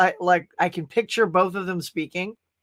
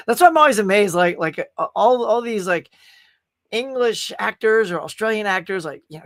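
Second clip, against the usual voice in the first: ordinary talk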